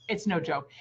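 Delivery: ordinary talk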